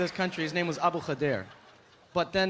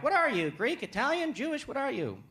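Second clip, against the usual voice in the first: high, probing questions; raised voice, quizzing someone's background; nosy stranger; 7 semitones higher than usual. Acted. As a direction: pester like a curious stranger. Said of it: "people would be like".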